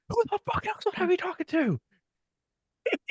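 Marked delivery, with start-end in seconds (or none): 0.1-1.8 fast, very high mock outburst; imitated incredulous squeal; exasperated retort. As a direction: fire it back in a strained high voice.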